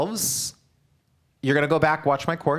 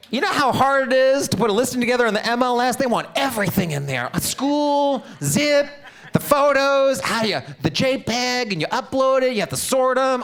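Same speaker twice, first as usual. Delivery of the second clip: much higher and louder, fast at first; fast, much higher, louder exasperated complaining; exasperated rant; 11 semitones higher, 5 dB louder than usual. Real, not acted. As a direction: vent the frustration of the paperwork in a rising tirade. Exasperated rant about MLS listing.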